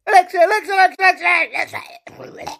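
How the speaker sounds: high, loud rapid-fire shouting; exasperated repeated calling. Real, not acted. [name] calling an Alexa that's not listening, exasperated shouting. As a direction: yell the name over and over, losing patience. Device keeps ignoring the repeated calls.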